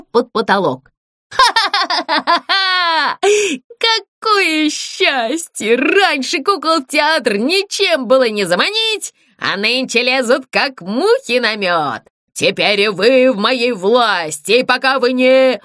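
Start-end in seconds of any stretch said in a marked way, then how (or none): 1.3-3.2 high, slow delighted cackle; gleeful ghost laugh. Acted. Ghost laughs at the new puppets, gleeful cackle. 3.8-15.6 gloating ghost. Acted. Ghost gloats over the trapped puppets, slow.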